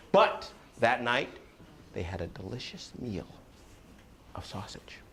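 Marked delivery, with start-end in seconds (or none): 1.9-5.0 softly, secret-like; hushed ending. A narrator's reading. Storyteller hushing the children for the tale's end. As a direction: drop to a whisper for the punchline.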